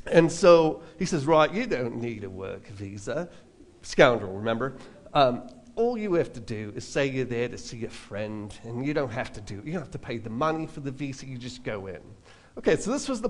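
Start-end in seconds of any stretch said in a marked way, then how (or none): 1.2-3.3 Australian impression. Road manager promising no visa needed, lightly Australian. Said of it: "terrible impression of him". 5.7-12.0 Australian impression. Road manager promising no visa needed, lightly Australian. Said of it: "terrible impression of him".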